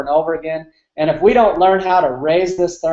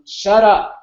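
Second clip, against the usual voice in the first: mild mock yell; 4 semitones higher than usual. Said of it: "yelling and telling".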